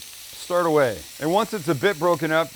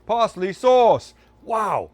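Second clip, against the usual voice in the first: delighted; 7 semitones higher, 8 dB louder, much slower than usual. Real, not acted. First taste, delighted.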